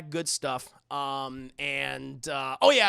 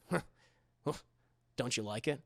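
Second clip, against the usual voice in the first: low, soft creep voice; creepy come-on; 7 semitones lower, 6 dB quieter, faster than usual. Retold or imitated. Man petting a woman who objects, low creepy murmur.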